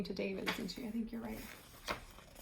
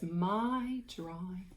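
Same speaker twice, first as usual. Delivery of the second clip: slow label reading; 4 dB quieter, much slower than usual. Shown as a demonstration.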